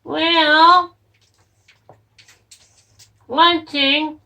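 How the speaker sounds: high, loud cartoon voice; shrill puppet voice piping up; high squeaky puppet. Puppet Frank speaks up.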